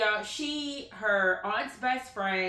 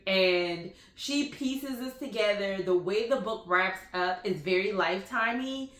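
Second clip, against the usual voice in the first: normal talking voice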